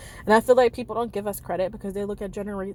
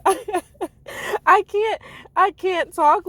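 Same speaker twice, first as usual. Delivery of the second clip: breaking into high laughter; can barely get the words out for laughing; 11 semitones higher, 6 dB louder, much slower than usual. Real, not acted. Vlogger cracking up at her own impression, laughing.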